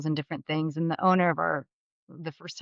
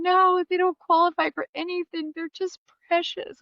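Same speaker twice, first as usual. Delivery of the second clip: imitating Darla's gushing reply; 12 semitones higher, 3 dB louder than usual. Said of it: "Darla's reply in story".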